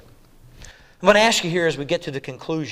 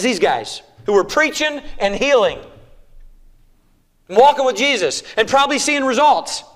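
loud, higher, fast-starting sermon climb; full-force preaching; 10 semitones higher, 11 dB louder than usual. Acted. Preacher warning believers, full-force preaching. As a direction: build it up and drive it home.

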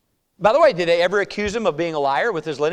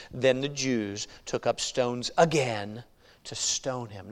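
dropped to a near whisper, much softer; hushed reading; 6 semitones lower, 8 dB quieter, much slower than usual. Acted. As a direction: let it fall to a hush, as if it were ominous.

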